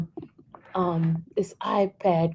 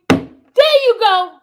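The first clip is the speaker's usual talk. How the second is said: very high, squealing excitement; real excitement; 12 semitones higher, 9 dB louder, slower than usual. Real, not acted. Calling Bree to remember what was read, wildly excited. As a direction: burst out, voice shooting far up. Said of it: "I got a little bit excited".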